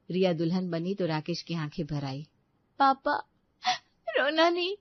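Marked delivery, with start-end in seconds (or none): 2.8-4.8 tearful bride. Acted. Bride comforts father at wedding.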